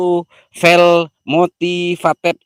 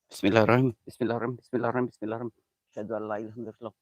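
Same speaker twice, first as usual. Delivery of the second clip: much softer, hurried prayer under the breath; scared murmured prayer; 4 semitones lower, 10 dB quieter, much faster than usual. Real, not acted. Frightened streamer praying during the summoning, soft and hurried. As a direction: pray quietly to calm your nerves.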